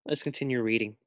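usual speaking voice

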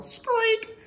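little bird cry; seagull squeak. A narrator's reading. Seagull noise.

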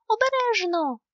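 high, loud urgent warning; crying out a warning. A narrator's reading. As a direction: shout to stop them in time. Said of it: "скрикнув Нюхмумрик".

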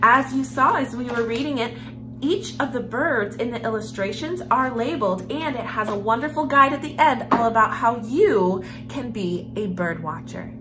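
usual speaking voice

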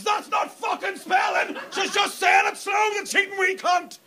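high-pitched acted shout of fury; outraged scream. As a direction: scream it as a hammed-up outburst. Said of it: "and scream the words".

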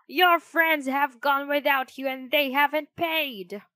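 high, loud, cross innkeeper; grumpy voice. A narrator's reading. Innkeeper to Pinocchio about unpaid bill, loud and grumpy. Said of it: "called a grumpy voice".

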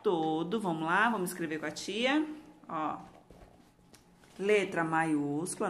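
ordinary talk

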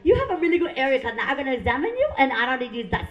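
chatty put-on Vietnamese accent. Imagined gynecologist about to examine patient, Vietnamese accent. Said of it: "I love their accent".

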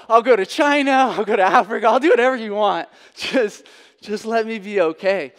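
loud, high, pleading bargain; desperate plea. Retold or imitated. Patient bargaining with God, pleading loudly. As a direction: beg God to spare you.

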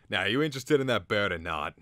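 loud, wheezy shopkeeper's question. A narrator's reading. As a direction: press the customer with a wheeze. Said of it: "he asked wheezily".

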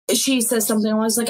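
usual speaking voice

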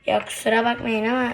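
ordinary talk

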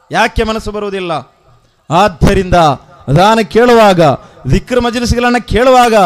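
building to a very loud preaching shout; full-force exhortation. Real, not acted. (ಅಬ್ಬರದಿಂದ ಹುರಿದುಂಬಿಸುವ ಪ್ರವಚನ)

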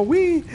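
playful high yelp; mock flying yelp. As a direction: imitate a yelp as she flies. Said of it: "es como".

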